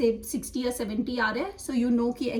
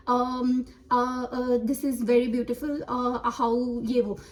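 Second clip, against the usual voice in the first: halting, with drawn-out ums; hesitant, filler-filled speech; much slower than usual. Acted. Teacher acting out a student searching for words, halting. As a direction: stall on fillers as if thinking mid-answer. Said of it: "हम pause हो जाते हैं".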